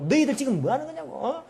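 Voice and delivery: angry scolding. Acted. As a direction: act out an eldest brother scolding his juniors. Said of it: "막 뭐라 하는 거예요".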